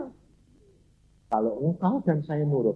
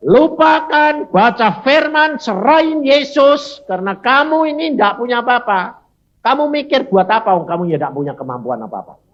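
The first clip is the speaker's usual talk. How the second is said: full-force preaching; 9 semitones higher, 10 dB louder than usual. Acted. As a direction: hammer each command home from the pulpit. Preacher exhorting the congregation.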